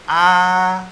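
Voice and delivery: "A" held long, high and loud on a level tone; model vowel A. Shown as a demonstration. Teacher modelling the vowel for learners, long high "A". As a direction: project a long even "A". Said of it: "A juga sama seperti bahasa Indonesia".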